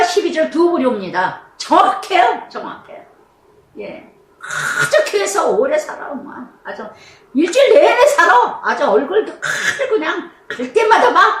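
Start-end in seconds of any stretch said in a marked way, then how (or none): 2.5-4.1 second 정확해요 dropped to a whisper; whispered echo. Real, not acted. Preacher echoing herself in a hush. 7.3-11.4 high, loud laughing jab; laughing mock. Real, not acted. Preacher mocking a churchgoer with a laugh. Mocks churchgoer who never leaves.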